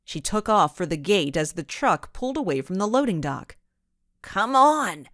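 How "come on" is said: a sharp, louder call to run; yelling. A narrator's reading. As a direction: urge her friend to run, loud. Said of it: "she yelled".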